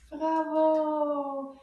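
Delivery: ordinary delivery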